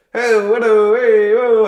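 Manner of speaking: high, loud showman whoop; forced hype. Retold or imitated. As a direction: pump up a silent crowd. Imitating forced hype to crowd.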